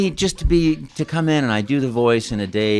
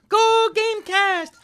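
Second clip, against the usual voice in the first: high, slow, cheerful Mario shout; slower than usual. Acted. Mario greeting the show's listeners cheerfully. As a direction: belt it out way up high. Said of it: "that was my line".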